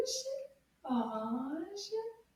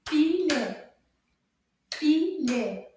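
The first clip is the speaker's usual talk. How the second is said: "Filet" in two loud beats; syllable by syllable; 8 dB louder, slower than usual. Shown as a demonstration. Teacher counts syllables with children, "Filet" loudly beaten out. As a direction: hit each syllable of "Filet" hard. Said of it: "Let's count the syllables".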